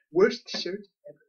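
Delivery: mock-scornful comic nerd impression; Comic Book Guy. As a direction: throw out the catchphrase as a snooty comic shop owner. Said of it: "the comic book guy from Simpsons".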